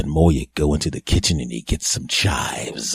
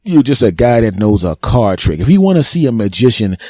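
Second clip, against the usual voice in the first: ordinary delivery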